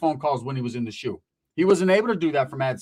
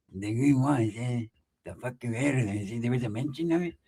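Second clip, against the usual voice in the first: secretive hushed voice; 6 semitones lower, 6 dB quieter than usual. Retold or imitated. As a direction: murmur it like a secret. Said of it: "Why are you being all quiet".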